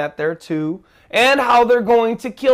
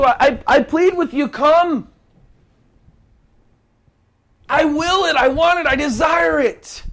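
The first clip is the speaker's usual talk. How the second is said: high, loud pleading sermon voice; fervent preacher's cry; impassioned preaching; 8 semitones higher, 4 dB louder than usual. Acted. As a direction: preach it out like a plea. Preaching at full force.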